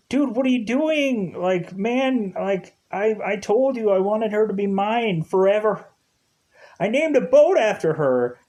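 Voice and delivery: high-pitched hurt pleading, lightly voiced; a betrayed friend whining up high; upset Seth. Friend confronting Ryan over Summer, high hurt whine.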